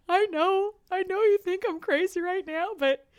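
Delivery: high tearful plea; retelling a tearful breakdown; crying. Retold or imitated. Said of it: "And I started crying".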